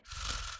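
imitated heavy, breathy sighs; heavy breathing. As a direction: breathe out heavily, imitating a crowd. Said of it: "everyone just sort of breathing heavy".

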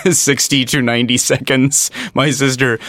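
usual speaking voice

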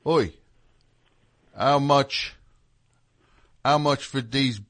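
put-on tentative English drummer's accent; English accent impression. Imitating a drummer buying produce, English accent. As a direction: try out an English accent, haltingly. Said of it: "So he would say something like".

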